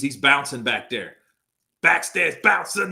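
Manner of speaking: ordinary talk, nothing marked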